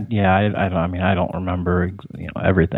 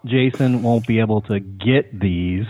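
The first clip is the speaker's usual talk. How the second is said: changed joke tone; 3 semitones higher than usual. Pretend. Punning to co-hosts about exclusive toys, exaggerated joke tone. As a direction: stretch it out and lift the pitch to flag the joke. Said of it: "change your whole tone and your, your whole, uh, diction".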